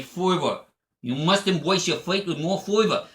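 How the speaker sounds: lightly voiced New York accent, R's dropped; a hint of a New York accent. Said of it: "where people will say".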